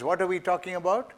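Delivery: ordinary delivery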